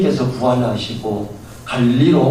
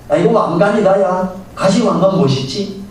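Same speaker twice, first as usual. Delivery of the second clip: mocking taunt; 6 semitones higher than usual. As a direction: sneer as you press the thorns down. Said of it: "이러면서 조롱을". (높고 크게 비웃는 조롱)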